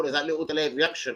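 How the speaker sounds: usual speaking voice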